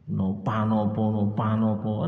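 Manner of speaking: careless, mocking nopa nopo; 1 rude mock reply. Shown as a demonstration. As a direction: toss off nopa nopo rudely. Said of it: "Nggak sopan".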